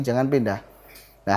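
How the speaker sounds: normal talking voice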